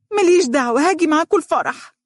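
put-on a little girl shrieking her demand; shouting girl. A narrator's reading. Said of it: "وهي تصيح". (صياح طفلة بصوت عال)

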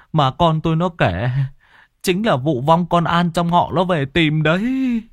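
high, panicked pleading to the master; a frightened old man begging for help; shaky high voice of a terrified father; trembling fear. Acted. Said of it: "run lên bần bật".

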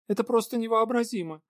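high, upset exclamation; dismayed. Acted. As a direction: cry it out in despair. Anya in dismay.

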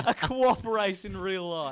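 high, loud, slowed by laughter; laughing. Real, not acted. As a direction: crack up while saying it. Cracking up at the idea.

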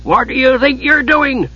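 high-pitched, indignant dwarf demand; Flint demanding. A narrator's reading. Dwarf confronts the idle Kender, demanding. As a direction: challenge him crossly, pitched well up. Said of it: "Flint demanded".

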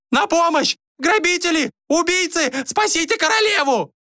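very high shrieking alarm; wailing lady-in-waiting. A narrator's reading. Lady-in-waiting on the stairs summons guards, high wails. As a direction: scream for help in a high female voice. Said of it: "С лестницы доносились вопли фрейлины".

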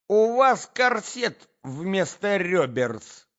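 high, loud, slow taunt; screechy drawn-out jibe; jeering Natalya. A narrator's reading. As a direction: add an insult in full quarrel voice. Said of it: "прибавила Наталья Дмитриевна".

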